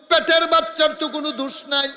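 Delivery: high, loud, quickly chanted; full-force preaching. Acted. Prophet defending the unborn child, high preaching chant. Chanted repeat of calm line.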